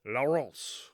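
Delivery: slow, exaggerated French accent reading a title; mock French accent, hamming up the title; bad French accent. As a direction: drawl it out in hammy French. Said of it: "my French accent".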